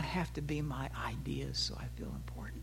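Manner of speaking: soft whisper, a confiding aside; sermon aside whispered to husbands. Acted. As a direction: let it drop to a whisper to the men.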